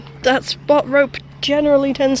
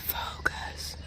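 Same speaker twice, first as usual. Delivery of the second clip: whisper; 17 dB quieter than usual. Real, not acted. Whispered word in a quiet moment.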